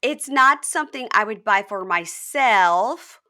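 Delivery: loud, slow, high imitated put-down; mock disdain. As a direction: draw each phrase out with exaggerated distaste. Retelling her disdainful verdict on ring.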